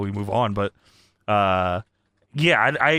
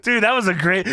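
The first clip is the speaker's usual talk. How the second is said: ordinary talk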